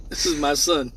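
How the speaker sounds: bursting into high laughter; laughter breaking up the words; laughing. Real, not acted. Laughing at her son's joke mid-livestream, cracking up.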